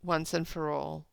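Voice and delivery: slow, measured poem recital; deliberate reading of verse. Acted. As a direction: read the lines with weight. Rereads the same lines.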